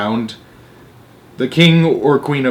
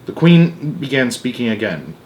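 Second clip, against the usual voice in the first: ordinary delivery, nothing marked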